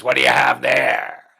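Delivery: deep loud troll demand; troll queen hag. A narrator's reading. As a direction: growl it like a greedy troll queen. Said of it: "the Troll Queen hag says".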